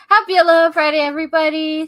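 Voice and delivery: loud, high show welcome; excited greeting. Real, not acted. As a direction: hail the whole audience loudly. Greets the audience.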